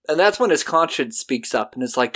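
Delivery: usual speaking voice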